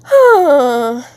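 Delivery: loud, high voiced sigh; high, heavy sigh. Real, not acted. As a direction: let out a big loud sigh. Exasperated sigh after admitting no money.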